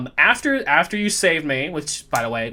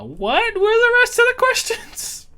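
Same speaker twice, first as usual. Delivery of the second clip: high voice; 4 dB louder than usual. Acted. Mock high voice question.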